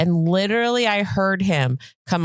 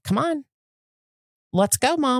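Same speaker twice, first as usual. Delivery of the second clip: high, urging cat voice; voicing the cat; 7 semitones higher than usual. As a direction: nag like an impatient cat.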